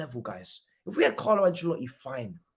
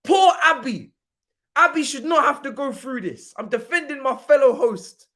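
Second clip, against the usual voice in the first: high, raised-voice protest; spring loudly to her defence; impassioned, pitched-up outrage; indignant shouting; 6 semitones higher, 4 dB louder than usual. Real, not acted. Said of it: "don't ask me why I'm shouting".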